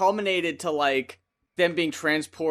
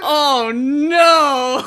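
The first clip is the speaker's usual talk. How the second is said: mock wail; 9 semitones higher, 9 dB louder than usual. Muppets learn Jim Henson died.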